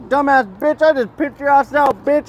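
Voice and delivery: high-pitched mock yell at a rival; over-the-top taunting voice, pitched high; acted yelling. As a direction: ham it up like a trash-talking heckler.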